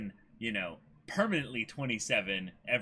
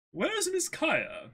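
much higher, slow, sing-song question; high servant voice; much slower than usual. Acted. Usopp calling for Kaya in a much higher, slow voice. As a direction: call out politely for her.

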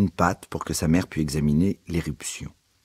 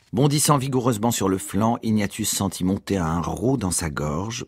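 plain reading voice

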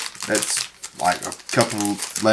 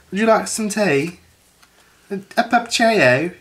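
pitched-up put-on British tea offer; mock British accent; 8 semitones higher than usual. Retelling his joke to a co-worker in mock British. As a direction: ham up the posh Brit with tea. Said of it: "I was sitting there saying".

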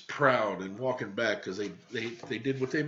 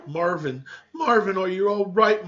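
calling anxiously down the pipe, louder; 7 semitones higher, 7 dB louder than usual. Acted. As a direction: shout down to him, a bit worried. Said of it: "Mama's voice echoed through the thin pipe".

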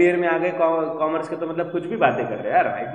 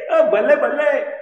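loud, high, slow balle balle cheer; celebrating cheer; 10 semitones higher, 6 dB louder, slower than usual. Acted. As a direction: cheer like you just got a yes. (खुशी से ज़ोर से बल्ले बल्ले)

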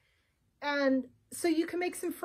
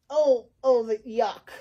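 loud, mock-disgusted outburst; disgusted shark; 2 semitones lower, 6 dB louder, slower than usual. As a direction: play up the disgust.

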